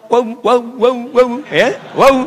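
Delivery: teacher calling out "waw" loud; drilled waw. Shown as a demonstration. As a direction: round the lips loudly on "waw". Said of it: "Agak terpisah".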